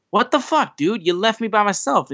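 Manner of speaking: a betrayed friend, half-joking disbelief; mock outrage. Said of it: "Sammy's like".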